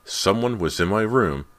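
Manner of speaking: loud, high insistence; angry. A narrator's reading. Girl reporting an intruder to her parents, angry. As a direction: insist angrily to be heard. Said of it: "she said angrily".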